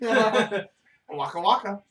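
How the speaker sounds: silly voice. Pretend. Silly Fozzie bit.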